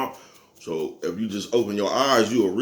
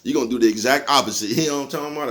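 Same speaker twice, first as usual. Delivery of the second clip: ordinary delivery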